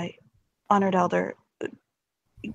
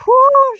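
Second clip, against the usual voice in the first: high, loud exhaled whew; loud sigh of relief; relieved whew; 9 dB louder than usual. Acted. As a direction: let out a big whew of relief. Player voices relief after tense question.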